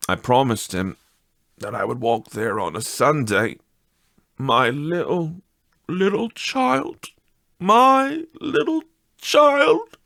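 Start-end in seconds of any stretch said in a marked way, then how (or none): 4.4-10.0 a grieving father cracking into tears, slowing on each word; breaking down. Acted. Bob grieving his dead little child, voice breaking. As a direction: voice climbing high and thin as he breaks down over his child. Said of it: "He broke down all at once".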